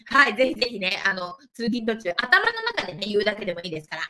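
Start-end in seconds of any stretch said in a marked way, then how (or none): none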